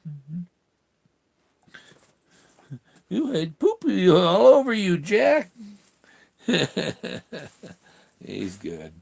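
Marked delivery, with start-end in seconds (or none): 0.1-5.5 high, lilting pet voice. Real, not acted. Owner greets dog Jack coming back, high baby talk. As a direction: fuss over the dog up high.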